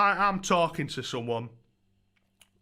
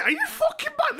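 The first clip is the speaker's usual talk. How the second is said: disbelief. Retold or imitated. Incredulous reaction to the taunting lad.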